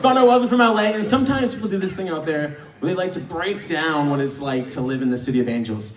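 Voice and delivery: ordinary talk, nothing marked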